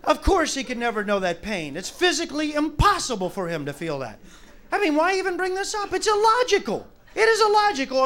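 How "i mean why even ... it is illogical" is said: exasperated high. Acted. Exasperated argument.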